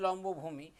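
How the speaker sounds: normal talking voice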